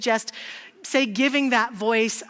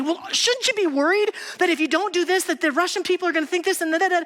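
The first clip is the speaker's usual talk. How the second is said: higher, fast, fretting lawyer; anxious Donovan; 7 semitones higher, faster than usual. Retold or imitated. Lawyer pressing his client, fast and anxious. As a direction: rattle off the worries fast and high. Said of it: "then he'd say".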